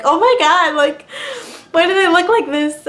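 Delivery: high, loud shocked cry; loud high oh my God. Retold or imitated. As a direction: gasp at your own feet in disbelief. Shocked at swollen feet.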